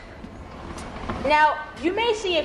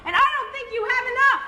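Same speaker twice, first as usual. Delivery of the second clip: high pig voice, last line fast and laughing; smug little pig, sneering high; mocking piglet; 8 semitones higher, faster than usual. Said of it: "pig said".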